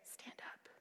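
hushed, gentle whisper of God; soft whispered call to stand. Retold or imitated. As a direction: breathe it softly, like a tender command. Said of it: "I heard him very gently say".

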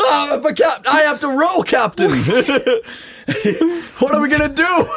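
high, whiny panic; panicked sailor. Acted. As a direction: plead in a frantic high voice. First mate panics.

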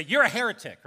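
sharp, loud accusation flung out; accusing shout. Retold or imitated. Religious teachers denouncing Jesus, loud accusing shout. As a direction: cry it out like an outraged scold. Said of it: "they'll say".

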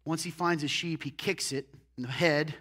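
ordinary talk, nothing marked

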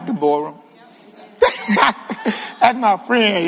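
usual speaking voice

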